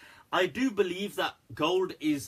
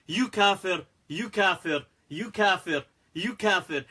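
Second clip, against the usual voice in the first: repeated falling, slightly raised accusation; mock accusing; 3 semitones higher, 4 dB louder than usual. Mimicking Muslims who expel each other, mock accusing. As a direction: fire off each accusation with equal punch.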